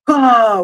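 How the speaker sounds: high, loud mock "ah" yell; acted battle yell. Guy acting the sword strike before the guards, loud mock yell.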